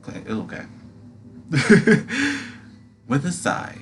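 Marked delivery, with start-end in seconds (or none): 1.3-2.6 high laugh. Real, not acted. Laughs at own flub.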